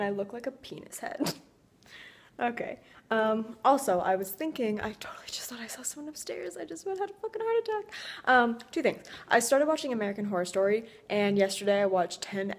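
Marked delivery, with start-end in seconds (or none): 4.8-6.6 hushed, spooked whisper; breathless frightened whisper; scared whisper. Real, not acted. Startled by a figure upstairs. 6.6-7.9 shaken. Real, not acted. After the fright upstairs.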